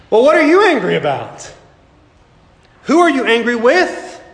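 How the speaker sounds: high probing questions; pressing questions. Acted. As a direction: push them to name their anger. Preacher pressing the congregation.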